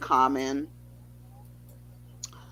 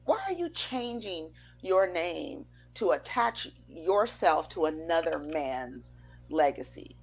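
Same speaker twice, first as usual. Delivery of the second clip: ordinary talk, nothing marked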